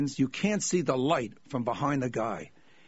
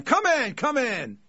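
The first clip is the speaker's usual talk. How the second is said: much higher, louder shouted come in; scream; 10 semitones higher, 3 dB louder, slower than usual. Retold or imitated. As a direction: yell it through the door. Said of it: "So I scream this time".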